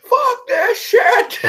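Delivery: high, slow, loud mock yell; hammed-up outraged holler; heckling. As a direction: drag out a shouted curse. Keeps heckling the film, acted.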